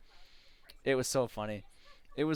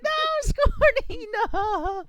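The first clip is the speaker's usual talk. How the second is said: high, loud mock-anguished cry; dramatic wail; 7 dB louder than usual. Player watching teammate get pancaked, hammed-up anguished wail. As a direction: wail for a fallen comrade, hammed-up. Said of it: "I didn't say that out loud".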